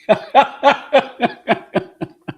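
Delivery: high, loud burst of laughter; real rapid-fire laugh, tailing off. Real, not acted. Player amused at the boring ditch-digging answer, laughing.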